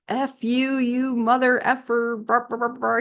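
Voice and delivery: high-pitched censored tirade; mock cussing out. Angry lady turning on a preacher, mock cussing. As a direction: imitate a lady's cursing, trailing into nonsense noises. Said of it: "just started, like, cussing him out".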